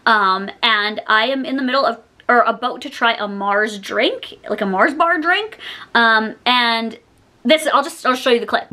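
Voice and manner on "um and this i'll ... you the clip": ordinary talk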